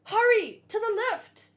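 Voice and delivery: high, loud shout; panicked shouted command; shouted urgency. Acted. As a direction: yell it to get them moving. Reading urgent manga dialogue.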